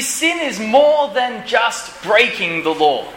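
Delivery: normal talking voice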